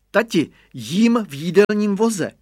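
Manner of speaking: put-on high, loud proud child's call; boy calling back from the cart; child's high shout. A narrator's reading. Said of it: "křikl Radovan".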